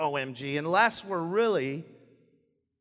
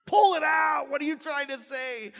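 much higher, louder mock shout at the kid; impatient shout; 10 semitones higher, 5 dB louder than usual. Dad urging his stammering child, mock shout. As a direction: yell it, playfully exasperated. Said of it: "And you're like".

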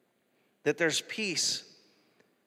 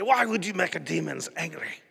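imitated foreign accent, punchy question; Reinhard Bonnke accent. Preacher quoting evangelist's teaching in his weird accent. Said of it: "he's got this accent".

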